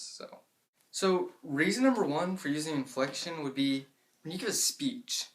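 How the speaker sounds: ordinary talk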